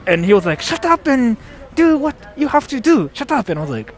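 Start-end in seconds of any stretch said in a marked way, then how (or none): 0.6-3.5 high imitation of a bossy gamer; mock angry teammate. Guy telling him to get on with it, mock angry. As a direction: order them about in a high mimicking voice. Same rude teammate retold.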